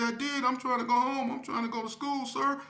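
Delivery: high, loud frightened pleading; scared pleading. Retold or imitated. Scared fourteen-year-old pleads with police, high panicked voice. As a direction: beg them to believe you. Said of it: "I said".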